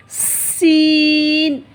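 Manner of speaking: सीन drawn out very slow with a thin whistling s; whistling letter. Shown as a demonstration. Teacher shows students the thin whistling sound. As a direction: stretch सीन with a long whistling s. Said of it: "सीटी की तरह होगी".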